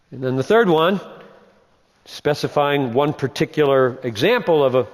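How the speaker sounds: ordinary delivery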